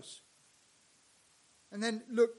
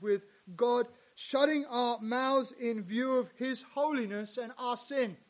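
normal talking voice

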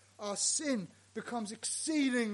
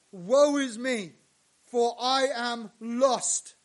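slow lament; 2 semitones higher, 9 dB louder, slower than usual. Retold or imitated. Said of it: "I said".

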